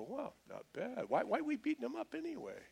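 high, soft mock puzzlement; puzzled second thoughts. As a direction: wonder aloud in a high, hushed voice. Mob acted as won over.

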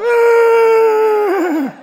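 long, very high-pitched loud shriek; hammed-up squeal of panic, held out; mock terrified scream. Preacher mocks the men hiding from the tomb, shrill comic scream.

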